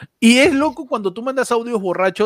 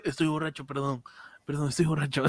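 put-on mumbled, much softer drunk apology; town drunk; 5 semitones lower, 13 dB quieter than usual. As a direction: slur it low like a sorry drunk. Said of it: "uno tiene que decir". (borracho arrastrando las palabras, muy bajito)

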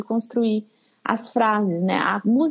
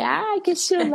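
playful exclaim; 7 semitones higher than usual. Shown as a demonstration. Acting song punchline to baby.

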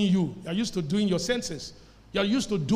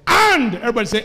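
loud, higher preacher's shout; emphatic call-out; 4 semitones higher, 12 dB louder, faster than usual. Real, not acted. Preacher prompts the congregation to say it, emphatic shout. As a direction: punch out AND for the crowd to repeat. Calls congregation to repeat a word.

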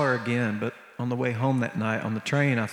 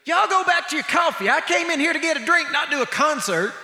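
much higher and louder sassy call; sassy lady; 6 dB louder than usual. Retold or imitated. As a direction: hand on hip, shoo the whole room away. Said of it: "she put her hand on her hip ... and she goes".

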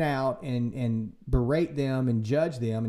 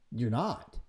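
ordinary delivery

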